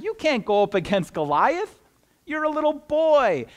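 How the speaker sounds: disbelieving, pitch leaping up at the end; acted royal incredulity; incredulous king. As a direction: dismiss the young challenger in high-pitched disbelief. King Saul doubts David, acted.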